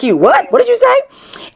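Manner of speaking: high, drawn-out astonished double-take; incredulous. Retold or imitated. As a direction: pitch shooting up in mock disbelief. Acting out startled reaction to [name]'s name.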